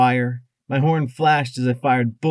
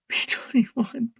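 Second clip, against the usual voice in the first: begging whisper; 12 semitones higher, slower than usual. A narrator's reading. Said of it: "I begged his slack face".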